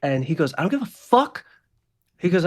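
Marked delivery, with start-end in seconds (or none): 0.6-1.4 high, loud gunman outburst; angry defiance. Acted. Gunman to nurse, angry defiance. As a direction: throw it out defiantly, pitched up. Gunman refusing hospital rules.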